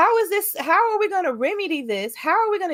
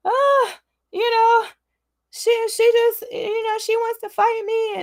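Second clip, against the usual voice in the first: mocking complaint; 7 semitones higher than usual. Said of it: "just be like".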